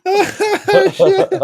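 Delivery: high, loud laughing "oh shit"; laughing exclamation. Real, not acted. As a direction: burst out laughing at the joke. Bursts out laughing.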